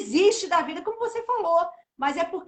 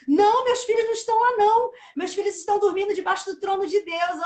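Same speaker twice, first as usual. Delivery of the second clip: loud, higher, emphatic retold rebuttal; almost quarrelling; 4 semitones higher, 6 dB louder than usual. Grieving mother contradicts her psychologist about her children, almost quarrelling. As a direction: insist forcefully, as if arguing back. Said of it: "quase que brigando".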